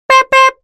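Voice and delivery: high little horn beeps; horn sound. Shown as a demonstration. Tuk Tuk beeping hello to friends, high horn. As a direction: toot it like a tiny horn. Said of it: "he sounds like this".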